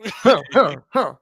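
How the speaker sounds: short punched cartoon grunts; Johnny Bravo. Showing friends the cartoon character's signature grunts. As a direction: grunt it out in separate bursts. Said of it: "That's Johnny Bravo".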